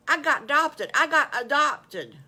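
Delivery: loud, high, bursting with news; elated high-pitched announcement; joyful shout. Acted. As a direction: proclaim it excitedly for everyone to hear. Wolf joyfully repeats his big news.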